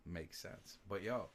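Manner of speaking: ordinary delivery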